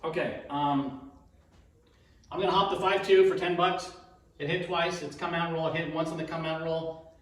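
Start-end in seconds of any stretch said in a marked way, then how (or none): none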